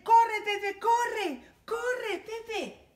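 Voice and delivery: high, loud cheering then fading with a laugh; excited chant urging the dog on. Acted. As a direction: cheer him on as he runs. Cheering Pepe on.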